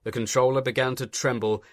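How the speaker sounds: usual speaking voice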